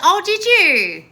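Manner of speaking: high, loud, slow oh did you; excited. Real, not acted. Host hearing a viewer quit her job, excited loud gushing. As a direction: gush with delight at their news.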